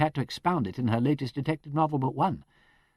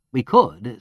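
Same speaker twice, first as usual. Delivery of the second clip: "We could" pressed firmly, a touch louder; with emphasis; 3 semitones lower, 9 dB louder than usual. Acted. Peter answering the superintendent, with emphasis. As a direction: lean on the words. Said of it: "with emphasis".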